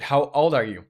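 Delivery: normal talking voice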